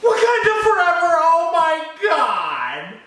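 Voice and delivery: high, loud, stretched-out cry of joy; overjoyed shout for the song; ecstatic cry. Real, not acted. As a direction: let it burst out of you, then hold the oh my God.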